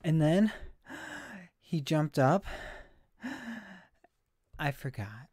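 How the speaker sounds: halting speech with big gasps between phrases; Stevie. Acted. Streamer imitating a breathless TV character, wheezy and halting. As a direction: push out a few words, then suck in air. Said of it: "I sound like that guy from".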